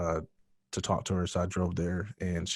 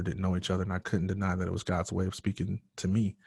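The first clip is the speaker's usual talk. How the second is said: normal talking voice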